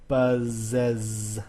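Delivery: BUZZES slowly split, buzz-ezz, with long Zs; separate plural syllable. Shown as a demonstration. Teacher demonstrating how to pluralize buzz, separated E Z syllable. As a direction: sound out "buzzes" in two drawn-out syllables. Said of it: "adding the E S".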